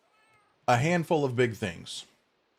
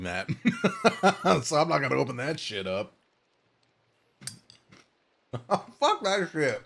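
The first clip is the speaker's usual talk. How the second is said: laughing; 3 semitones higher than usual. Real, not acted. Laughing at the chore.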